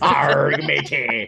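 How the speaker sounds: drawn-out pirate growl; hammed-up seafaring pirate. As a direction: do a stock pirate greeting. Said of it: "sound like a fucking pirate".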